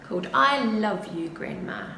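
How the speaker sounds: usual speaking voice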